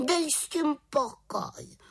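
high, slow, grumpy little witch; mrzutě. A narrator's reading. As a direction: brush it off crossly in a high voice. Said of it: "vykřikla mrzutě". (mrzutý vysoký hlásek čarodějnice)

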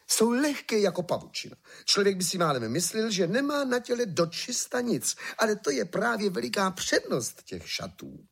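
high-pitched sales patter; gushing swindler. A narrator's reading. Swindlers showing the emperor invisible clothes, gushing high. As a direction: sell it eagerly, pitched well up.

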